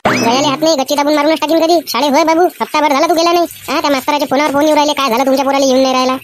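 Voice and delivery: mother yelling at her son to get ready for school; scolding mother. Acted. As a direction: nag the lazy son like a furious village mom.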